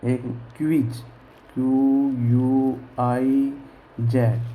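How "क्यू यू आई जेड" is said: letters read out one by one, drawn out; slow letter spelling. Shown as a demonstration. Teacher spelling the word slowly for the class. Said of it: "मैंने लिखा quick".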